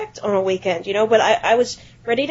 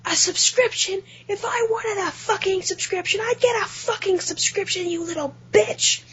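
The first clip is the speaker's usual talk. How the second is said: irate customer; 11 semitones higher than usual. Retold or imitated. Caller berates clerk over subscription advice, high mock fury. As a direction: imitate an outraged caller, much higher. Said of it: "And he goes".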